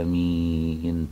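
آمين with the first vowel stretched slowly; long madd amen. Shown as a demonstration. Sheikh showing how Egyptians say amen in prayer, drawn out. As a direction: draw out the a of آمين, soft and slow. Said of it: "ويقولوا إيه؟".

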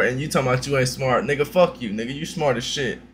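usual speaking voice

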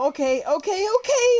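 very high crying shriek; shrieking panic. Real, not acted. Panicking, nearly dying.

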